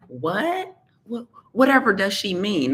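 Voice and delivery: high, prissy mock gasp of outrage; faux-scandalized whatever does she mean; mock shock. Mock shocked listener reaction.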